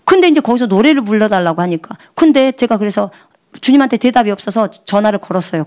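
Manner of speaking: ordinary talk, nothing marked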